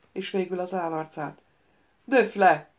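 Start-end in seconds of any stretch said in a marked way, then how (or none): 2.0-2.7 urgent, loud demand to be stabbed; loud plea. Acted. The ghost wizard begs the prince: loud plea. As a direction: cry it out, begging to be struck down. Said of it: "kérte Szajont".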